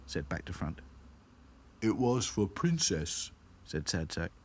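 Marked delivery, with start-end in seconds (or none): 1.8-3.3 slow, doleful doll voice. A narrator's reading. Sad Sack explaining the plan, earnest. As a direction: say it slowly and earnestly.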